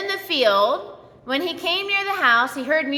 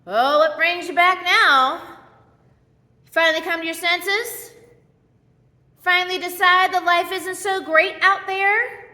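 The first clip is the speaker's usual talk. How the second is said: mock sneering father; 4 semitones higher than usual. Father mocking his runaway son, snide and higher. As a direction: sneer it like a grudging parent. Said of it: "the father doesn't sit back going".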